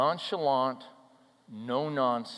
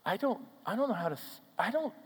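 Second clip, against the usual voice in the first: soft, halting, broken-off retelling of a tearful stammer; a quieter retold stammer; 2 semitones higher, 4 dB quieter than usual. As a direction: let the words catch and restart, softly. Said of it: "she was stammering".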